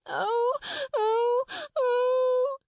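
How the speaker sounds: loud, drawn-out wailing howls; high child's wail. A narrator's reading. Lottie refusing to be silenced, high angry wails. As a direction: wail like a tantrum-throwing small girl. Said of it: "angry wails of some child".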